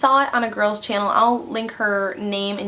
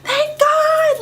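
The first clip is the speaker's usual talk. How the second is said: much higher, louder squeal of relief; overjoyed; 4 dB louder, much slower than usual. Retold or imitated. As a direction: shriek it with happy relief. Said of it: "And I was like".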